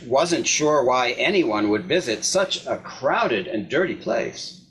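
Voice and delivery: plain reading voice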